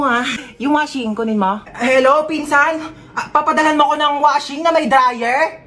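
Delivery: ordinary talk, nothing marked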